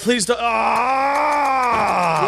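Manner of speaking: high panicked please, then a cry; desperate plea ending in a yelp; panicked pleading. Real, not acted. Character about to be hit.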